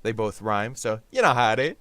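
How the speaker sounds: ordinary talk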